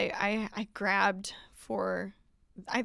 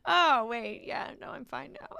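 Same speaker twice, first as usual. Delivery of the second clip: mock fine, laughing; 6 semitones higher than usual. Mock instant recovery, laughing.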